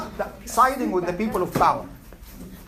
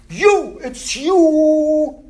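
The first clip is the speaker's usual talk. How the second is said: accusing; 7 semitones higher, 7 dB louder, much slower than usual. Retold or imitated. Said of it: "they say to the tyrant".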